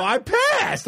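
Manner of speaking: very high, proud protest; squeaky mock-defensive kid; happy insistence. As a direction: insist on your win to a doubting dad. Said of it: "I was honestly happy about it".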